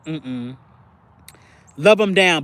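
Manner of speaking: usual speaking voice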